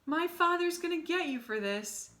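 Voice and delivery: high, loud bratty threat; angry threat. Acted. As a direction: spit it at him like a spoiled kid. Bully threatens as he runs off.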